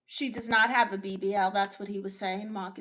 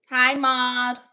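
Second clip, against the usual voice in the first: ordinary talk, nothing marked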